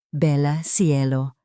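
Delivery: ordinary delivery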